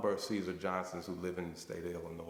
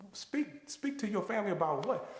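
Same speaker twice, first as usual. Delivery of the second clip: baffled question; 11 semitones higher than usual. Retold or imitated. Baffled at speaking request.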